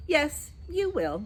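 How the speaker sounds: slow, firm nanny correction. A narrator's reading. Mary Poppins firmly corrects the twins, slow and firm. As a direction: set them straight, slowly. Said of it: "said Mary Poppins firmly".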